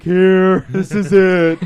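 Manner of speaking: loud, high, overwhelmed fan cry; flustered shout. Retold or imitated. Starstruck fan showing his badge to the artist, flustered shout.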